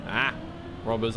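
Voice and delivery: ordinary talk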